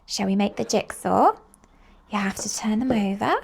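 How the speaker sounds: plain reading voice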